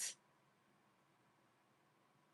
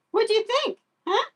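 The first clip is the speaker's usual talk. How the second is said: put-on high baby talk. Owner teasing the guinea pig about dating, high and giggly. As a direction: giggle then squeaky high question. Playful high question to pet.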